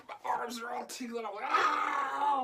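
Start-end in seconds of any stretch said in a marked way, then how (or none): 1.5-2.4 loud high shout of shock; pained shout. Retold or imitated. Brother shocked by sister's experiment, loud pained shout. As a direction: shout as the arms tingle. Stove and toaster electric shock.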